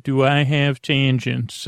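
ordinary talk

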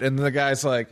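ordinary talk

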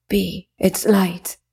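slow, faint, fading words; weak dying voice. Acted. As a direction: barely get it out, dying. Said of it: "he weakly repeated".

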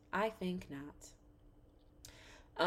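very soft, slow refusal; hushed dismissal. Real, not acted. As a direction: repeat it slowly under your breath. Dismissal repeated under the breath.

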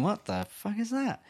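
high, slow, hushed bafflement; bewildered. Retold or imitated. Child in bed hearing strange noises downstairs, bewildered. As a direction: act out puzzled disbelief, softly and drawn out.

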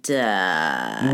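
very low growled threat; deep villain voice. Pretend. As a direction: drop into a monster growl.

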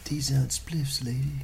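hippie whisper. Acted.

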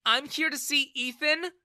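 high, loud, cross retort; angry reply. A narrator's reading. Daughter answers her dad, high and angry. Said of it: "she replied angrily".

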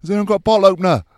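high, loud call to everyone; shouts down the carriage. Retold or imitated. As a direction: holler a question over a crowd. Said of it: "there were shouts coming down".

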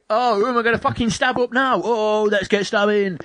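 high, hammed-up streamer babble; mock gleeful commentary in a high voice; obnoxious streamer. Mimics chattering streamer over cutscene.

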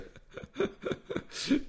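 airy high-pitched giggle; high breathless laugh. Real, not acted. Host cracking up at his own joke, breathless giggle.

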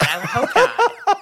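slow words with a laugh in them; amused laughing reply. Real, not acted. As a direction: tease back while laughing. Teasing reply amid laughter.

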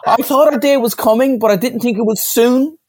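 high, theatrical voice-over read; hammed-up line read. Acted line read in booth skit.

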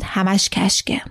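understated, certain dismissal; assured. A narrator's reading. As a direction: dismiss it calmly and surely. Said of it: "با همان لحن مطمئن گفت".

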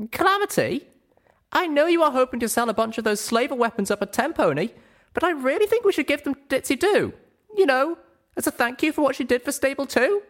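put-on very high, girlish character voice, pleading; earnest young heroine. Little Pip urges Calamity to give weapons away, high earnest pleading. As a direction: make a hopeful case in a high little voice.

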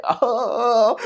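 a high pouty sigh; mock dismay. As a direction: act the crybaby who got ninety-nine. Retold reaction to a score.

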